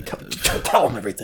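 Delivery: whispering. Real, not acted. Whispered prompt to co-host.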